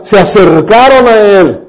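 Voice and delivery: ordinary delivery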